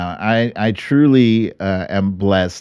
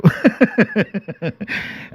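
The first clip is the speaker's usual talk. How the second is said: snicker snort; 9 semitones higher than usual. Real, not acted. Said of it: "called a snicker snort".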